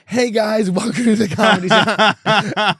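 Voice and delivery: high, loud, slow put-on stage cheer; fake-cheery host. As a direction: force a bright welcome through the sadness. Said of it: "And then being like".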